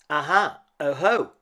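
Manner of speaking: loud, high triumphant exclamation; excited discovery. Acted. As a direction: cry out like you've found a clue. Child discovers trail in snow.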